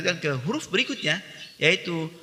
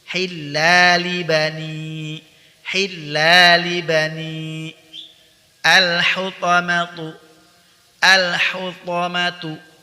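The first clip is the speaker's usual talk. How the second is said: loud, clear model recitation; recitation drill; 3 semitones higher, 5 dB louder, much slower than usual. Shown as a demonstration. Tahsin teacher modelling words for students, loud clear recitation. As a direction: recite each word loudly for students to echo.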